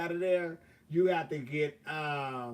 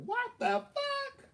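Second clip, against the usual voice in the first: much higher, slow shocked squeal; slower than usual. Retold or imitated. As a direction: squeal it out, stunned by the bang. Tire blows on freeway.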